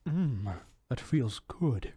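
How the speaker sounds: low, slow, contented mock groan; slow, low mock-blissful voice; savoring relief. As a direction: imitate relishing it, drawn out and low. Butter enjoys being cleaned.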